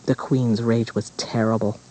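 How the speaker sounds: plain reading voice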